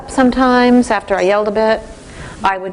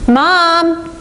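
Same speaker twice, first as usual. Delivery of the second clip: put-on high calling voice of a teenage son; 9 semitones higher, 3 dB louder than usual. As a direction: call for mom from the bathroom.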